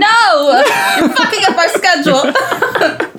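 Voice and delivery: loud laughing protest; laughing outcry. Real, not acted. Friend protesting the schedule change, loud laughing outcry. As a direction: yell it while laughing.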